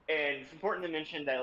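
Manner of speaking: normal talking voice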